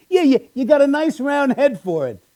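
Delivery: high, mildly cheery backhanded compliment; a hint of a chummy manager; lightly voiced coworker. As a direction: lightly mimic the boss's fake sincerity. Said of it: "He goes".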